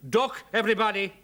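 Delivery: urgent bellow across the room; shouted warning. A narrator's reading. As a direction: yell for all to take cover. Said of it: "and he shouted".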